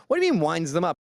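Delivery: ordinary talk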